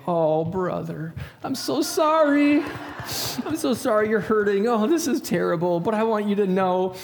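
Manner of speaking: high, drawn-out, syrupy consoling; mock heartfelt sympathy. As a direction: overdo the comforting, as if to someone hurting. Overdone comfort for a computer problem.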